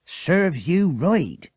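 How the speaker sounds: high, loud, very slow farmer's wife; snapping farmer's wife. Acted. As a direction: bite each word off at Bert. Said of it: "snapped Missus Ramsbottom".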